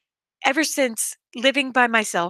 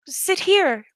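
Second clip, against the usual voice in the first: put-on much higher sweet child voice; child's voice; 7 semitones higher than usual. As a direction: sound like a small child. Child invites master to sit.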